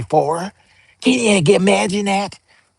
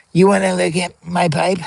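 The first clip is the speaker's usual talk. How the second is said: ordinary talk, nothing marked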